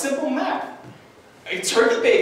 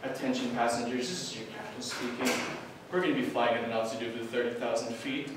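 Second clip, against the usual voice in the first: captain's intercom voice; 8 semitones lower, 11 dB quieter than usual. Retold or imitated.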